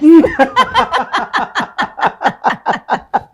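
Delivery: big high laugh, real and open; loud burst of laughter. Real, not acted. Guest cracks up at the host's teasing, loud real laughter.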